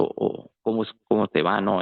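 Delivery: usual speaking voice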